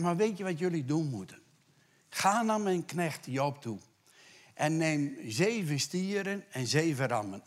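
fervent preacher, ringing out; preaching at full force. Acted. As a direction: proclaim God's command to the congregation. Preaching God's command forcefully.

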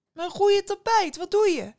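high, loud shriek of dismay; alarmed scolding yell; horrified mother. A narrator's reading. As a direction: cry out in outrage over the ruined carpet. Mother horrified about her carpet.

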